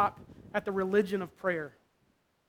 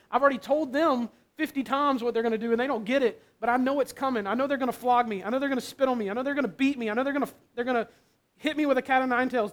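anguished pleading; 3 semitones higher than usual. Acted. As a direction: beg the Father, terrified of tomorrow. Jesus pleading with the Father, terrified.

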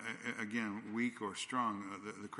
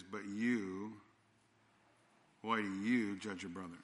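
"you" leaned on, held apart before the question; preacher quoting Paul with weight on "you"; stress on you; slower than usual. Shown as a demonstration. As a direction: set "you" apart with a pause, then ask. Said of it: "the emphasis is on the word "you".

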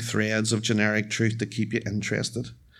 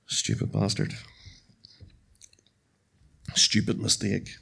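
bitter whisper to himself; whispered self-reproach; 5 dB quieter than usual. Real, not acted. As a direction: hiss it under your breath at yourself.

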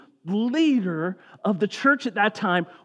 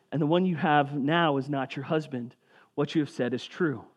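scripture reading; 4 semitones lower, 4 dB quieter than usual. Acted. Reads scripture passage.

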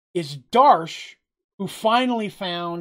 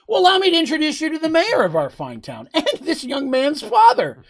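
higher, mock-courtly voice with a laugh breaking through; sly polite introduction; 10 semitones higher, 5 dB louder than usual. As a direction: introduce him sweetly, enjoying the trap. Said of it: "And Jacob's like".

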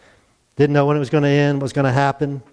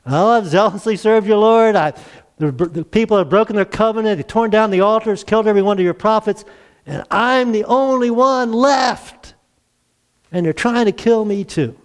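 higher-pitched, self-pitying prophet; complaining Elijah; 6 semitones higher than usual. Acted. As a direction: plead your case to God in a raised pitch. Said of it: "he gives him the same answer".

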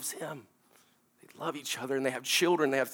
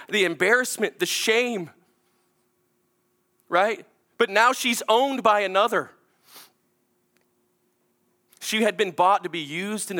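preaching; 8 semitones higher, 5 dB louder than usual. Acted. Preacher imagining her shame.

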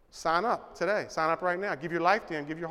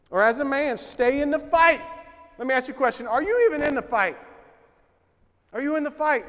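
higher, louder, pressing; rousing call; 6 semitones higher, 4 dB louder than usual. Acted. Preacher challenging the men to stay in the fight, rousing and loud. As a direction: push the challenge right at them.

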